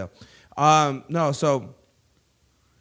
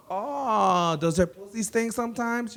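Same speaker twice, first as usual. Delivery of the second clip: Mauricia; 7 semitones higher, 3 dB quieter, slower than usual. Retold or imitated.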